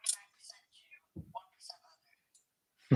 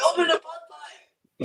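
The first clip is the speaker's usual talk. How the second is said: loud shout; 7 semitones higher, 20 dB louder, slower than usual. Acted. Mock chant at stream.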